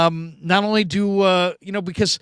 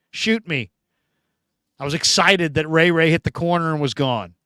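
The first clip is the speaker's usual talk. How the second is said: ordinary talk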